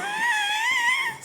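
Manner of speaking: very high, long wheezing laugh; helpless squeal of laughter. Real, not acted. Cracking up over the tied-up man, squealing laugh.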